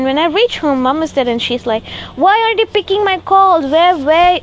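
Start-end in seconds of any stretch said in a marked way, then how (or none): 2.2-4.4 high, louder nagging mom voice; mimicking a scolding mother, pitched up. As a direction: mimic mom demanding answers. Mom scolding about missed calls.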